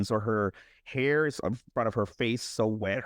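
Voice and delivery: ordinary talk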